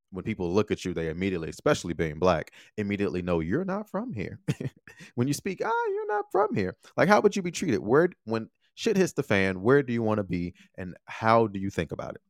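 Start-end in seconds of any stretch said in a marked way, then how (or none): 5.6-6.8 mock local voice. Locals spotting a foreigner.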